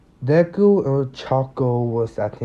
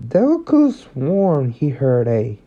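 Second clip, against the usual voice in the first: plain reading voice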